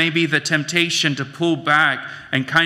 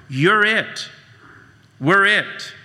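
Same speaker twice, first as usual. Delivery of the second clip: pitched up, punching each short line; emphatic; 6 semitones higher, slower than usual. Real, not acted. Pastor insisting to the congregation, emphatic and high. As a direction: hit it like a revelation. Pastor driving home the point.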